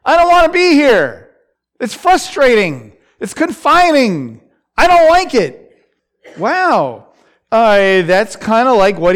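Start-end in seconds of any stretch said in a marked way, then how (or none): 0.0-5.6 higher, louder, fed-up pleading; frustrated plea. Retold or imitated. Preacher voicing Joseph, sick of prison, complaining higher and louder. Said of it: "He's saying".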